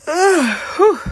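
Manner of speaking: loud high exhale whew. Real, not acted. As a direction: blow out a big whew. After yawn.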